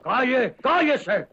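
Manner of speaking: sharp loud high command; drill sergeant. Acted. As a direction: snap him silent. Colonel silences sergeant.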